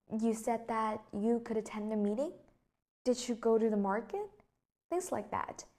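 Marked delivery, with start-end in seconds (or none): 0.1-2.3 checking question with a final rise on "meeting"; rising intonation. Shown as a demonstration. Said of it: "your tone of voice just basically goes up". 3.0-4.3 yes-no question climbing on "market"; rising intonation. Shown as a demonstration. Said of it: "your tone of voice just basically goes up".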